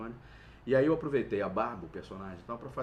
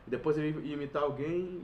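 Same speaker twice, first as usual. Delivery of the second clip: normal talking voice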